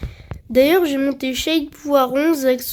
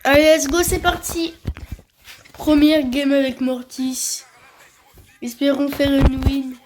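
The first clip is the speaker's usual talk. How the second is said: sucking a throat lozenge. Real, not acted. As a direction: voice changed by a sweet in the mouth. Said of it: "j'espère que vous entendez le changement de ma voix".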